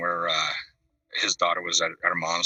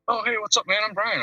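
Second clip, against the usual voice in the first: much higher, mock rock-star greeting; imitating a rock singer. Rock star walks into the house, greets him in a high mock voice. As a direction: pitch the greeting way up like a famous singer.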